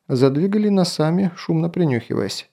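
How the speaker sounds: ordinary delivery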